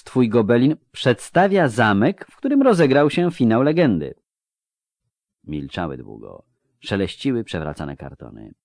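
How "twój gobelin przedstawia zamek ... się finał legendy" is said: higher, insistent challenge; feisty persistence. Acted. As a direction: refuse to let go, press it cheekily. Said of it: "zadziornie nie rezygnowała Konduiramurs". (zadziornie, z uporem)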